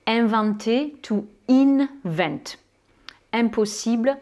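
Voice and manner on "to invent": "to invent" slowly, holding the first N; sounded English N. Shown as a demonstration. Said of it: "ils vont prononcer ce N ou ce M". (« to invent » lentement, N bien prononcé)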